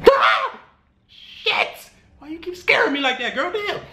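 mock high-pitched startled yelp and complaint; startled awake. Man woken by a scary-looking partner, startled. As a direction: act out a jolt of fright on waking. Acts out being scared awake.

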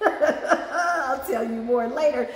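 slow, laughing through the words. Real, not acted.

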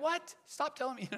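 mock-frustrated, high-pitched retort. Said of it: "you're frustrated with that".

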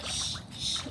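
sh, sh hissed without voice; sh sound hissed softly; whispered sh sound. Shown as a demonstration. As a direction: hiss the sh for the kids. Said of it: "do you hear the sound?".

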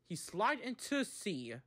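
ordinary delivery

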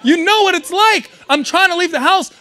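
normal talking voice

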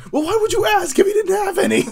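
very high, loud imitated yell; mock exasperation. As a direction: yell it in comic outrage, laughing. Acting out exasperated film line.

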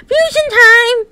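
high, loud, stretched-out whoop; excited battle cry. Acted. Character launching the fusion, excited cry. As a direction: call it out high like a triumphant announcement.

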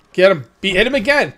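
loud, urgent cheering-on; real yelling. Real, not acted. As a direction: yell at a teammate to keep hitting. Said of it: "Who are you yelling at?".

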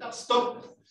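"stop" unvoiced, with a clear closing P; whispered stop. Shown as a demonstration. Teacher models "stop" for the students. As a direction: breathe "stop" out with the lips shut at the end. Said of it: "La P es con los dos labios juntos".

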